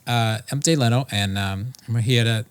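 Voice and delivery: half-lisped Leno voice, lightly put on; a fifty percent lisp, nasal-free; Jay Leno impression. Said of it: "You can't do the lisp".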